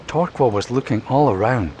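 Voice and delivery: ordinary delivery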